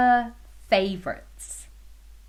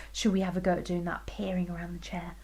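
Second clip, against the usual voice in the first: whispering; 4 semitones lower, 8 dB quieter, much faster than usual. Real, not acted. Hushed invitation to children.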